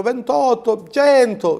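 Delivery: high, slow, swooning mock adulation; adoring Fantozzi crowd. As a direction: gush like the fawning crowd in Fantozzi. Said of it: "diceva quell'altro". (folla adorante alla Fantozzi, voce alta e lenta)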